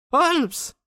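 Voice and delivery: very high, drawn call; rousing a sleeper. Acted. Visitor trying to wake the sick man, insistent high call. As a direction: call the name in a piercingly high pitch. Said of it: "quelqu'un qui aurait voulu réveiller un dormeur".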